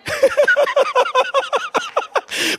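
long, very high-pitched giggle; high giggling laugh. Real, not acted. Preacher amused by his returning accent, high laughter.

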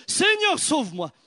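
high cry of fear on Seigneur; a hint of panicked Peter; cry for help. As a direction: cry out as if sinking. Peter cries out sinking.

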